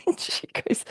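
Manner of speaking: usual speaking voice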